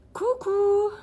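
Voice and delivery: high, loud sing-song "Coucou!" called out; bright greeting. Real, not acted. Greets a viewer arriving on her live stream with a high call.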